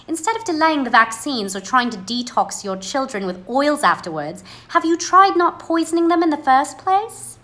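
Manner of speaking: a light, mock-polite British accent, pitch rising high at the end; a hint of a British accent, riding high. Shown as a demonstration. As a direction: soften a blunt line with a British accent. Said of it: "Try reading that in a British accent".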